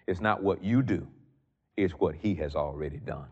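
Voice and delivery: normal talking voice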